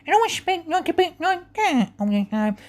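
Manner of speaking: very high, slow, slurred character voice; cleft palate impression. Lady with a cleft palate speaking, high muffled impression. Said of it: "cleft palate".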